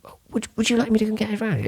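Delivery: high, rapid-fire offer of a round; Joe. Retold or imitated. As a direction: put on a high-pitched, hurried mate's voice. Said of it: "It's a good Joe impression".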